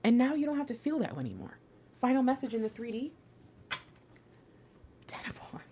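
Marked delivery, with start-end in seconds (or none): 5.1-5.7 breathless whisper, far softer. Real, not acted. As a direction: breathe the card name in awe. Names card in sudden whisper.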